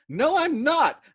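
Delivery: very loud, high shout. Acted. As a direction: yell the denial. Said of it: "You're still shouting".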